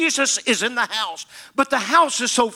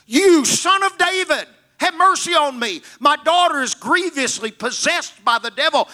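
crying out; 6 semitones higher than usual. Acted. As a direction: cry out to him for mercy, voice up high. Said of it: "she begins to cry".

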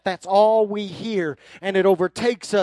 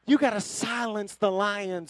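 ordinary talk, nothing marked

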